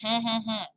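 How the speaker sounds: repeated nasal "hanh-hanh-hanh"; nasal sound demo. Shown as a demonstration. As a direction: honk the French nasal vowel three times up in the nose. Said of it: "It's up in your nose".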